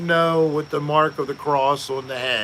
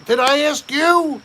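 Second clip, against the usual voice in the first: mock scolding; 10 semitones higher than usual. Host chides companion for answering first, mock scolding. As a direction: tell them off in a high, raised voice.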